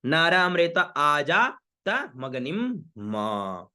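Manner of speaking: मगनिम hummed on "m", then a long "maa"; special halant ending. Shown as a demonstration. Teacher showing halant at mantra's end, slow held म. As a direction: chant slowly, lingering on the final म of मगनिम. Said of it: "हलंत युक्त आता है तो उसका विशेष उच्चारण".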